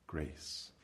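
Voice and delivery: a hushed, reverent whisper of Grace. Real, not acted. Preacher answering his own question in a reverent whisper.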